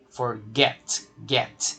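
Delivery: "Forget" stressed on GET, then "get" repeated alone; single out the final syllable "get" after "Forget"; stress on last syllable of forget. Shown as a demonstration. Teacher shows students the tonic syllable, "Forget" stressed on GET. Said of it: "é o final".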